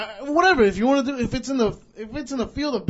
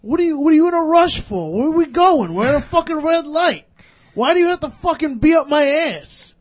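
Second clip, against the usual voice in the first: louder, higher re-enacted angry demands; retold angry confrontation; 5 semitones higher, 7 dB louder than usual. Driver confronts cabbie at red light, retold angry shouting. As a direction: replay yourself telling off the cabbie.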